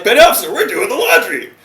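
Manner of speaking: high, loud mock-panicked protest; pleading recruit. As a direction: squeak out an excuse to your superior. Recruit answers angry petty officer.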